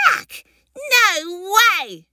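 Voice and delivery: high, emphatic little creature refusal; disgusted hatchling. Acted. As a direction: reject it in a squeaky child-creature voice. Baby rejects proper names.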